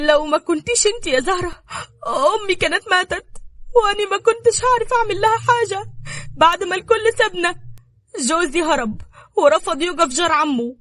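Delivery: high, wailing distressed voice; tearful lament. A narrator's reading. Nada weeping to Zahra about her dying mother, tearful. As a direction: pour out the grief in a high, pleading cry. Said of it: "دموعها نزلت أكتر وقالت".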